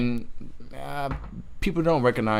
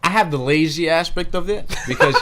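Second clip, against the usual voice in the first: laughing; 6 semitones higher, 6 dB louder than usual. Real, not acted. Laughing admission of laziness.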